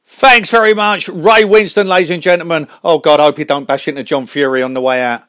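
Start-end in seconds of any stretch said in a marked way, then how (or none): 0.0-2.7 announcer's send-off. Acted. Host thanks departing guest, big announcer's send-off. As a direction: project it big to the audience.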